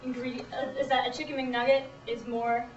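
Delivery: ordinary talk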